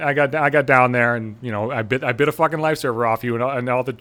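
normal talking voice

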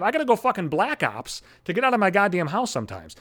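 normal talking voice

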